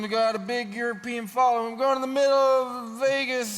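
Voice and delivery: put-on high, sing-song Swedish accent; hammed-up Swedish tourist voice. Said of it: "Is that a Swedish accent".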